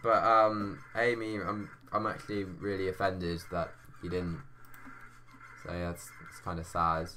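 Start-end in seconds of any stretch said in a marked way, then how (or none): none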